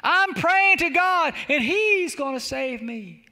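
high, drawn-out call back; slow acted holler of refusal; shouting back. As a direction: call it back across the water, stretching the words. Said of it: "shouted back".

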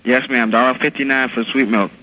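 ordinary talk

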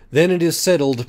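high, loud, firm decision; resolute leader. Acted. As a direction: settle it like the boss. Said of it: "Pam said".

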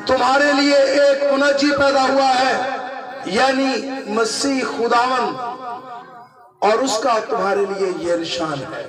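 usual speaking voice

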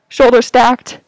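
ordinary talk

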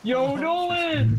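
drawn-out high call. Real, not acted. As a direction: call his name slowly across the map. Calling out to a teammate.

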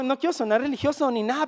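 ordinary talk, nothing marked

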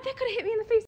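high, shocked exclamation; startled. Real, not acted. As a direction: blurt it out in shock. Just nearly hit by ball.

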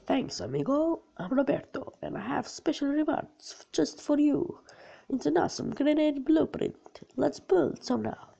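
put-on high, playful Spanish accent game character. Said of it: "I just sound like a Spanish guy".

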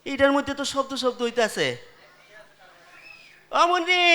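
ordinary talk

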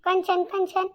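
calling out. Acted. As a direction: call her from across the house. Calling someone from another room. (दुरून हाक मारत)

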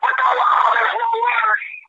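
heated retort in a debate; angry outburst. Real, not acted. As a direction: fire back hotly against the law. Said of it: "Why are you getting so angry".

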